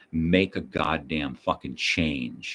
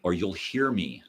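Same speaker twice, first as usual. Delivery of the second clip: ordinary talk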